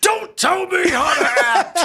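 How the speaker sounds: high, strained, slow mock shout; hammed-up outraged actor yelling; Rip Torn. Touchy actor rebuking the interviewer, hammed-up outraged shout. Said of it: "And he goes".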